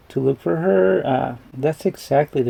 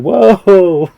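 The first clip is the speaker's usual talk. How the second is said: loud, higher amazed whoa; mind-blown whoa; 5 semitones higher, 11 dB louder than usual. Retold or imitated. Viewer retelling how a movie trick blew their mind, amazed. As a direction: let out a big booming "whoa" of amazement. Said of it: "It kinda blew my mind".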